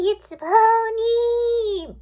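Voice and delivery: huge, long drawn-out high roar; Pinkie. A narrator's reading. Pinkie charging towards Twilight, wild roar. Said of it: "Pinkie roared".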